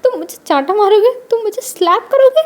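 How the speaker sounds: high, loud laugh through the words; laughing mockingly. A narrator's reading. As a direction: scoff through laughter at the threat. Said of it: "वांगजी हंसने लगता".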